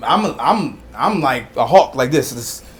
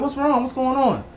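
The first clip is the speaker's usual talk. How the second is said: concerned dad; 11 semitones higher than usual. Retold or imitated. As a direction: re-enact checking on a crying child. Father asks crying daughter again.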